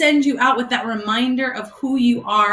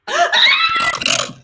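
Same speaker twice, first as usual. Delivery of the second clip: sharp, very high gasp of joy; delighted gasp. Real, not acted. Pastor seeing the student return on the call, joyful gasp. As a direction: gasp as if a loved one walked in.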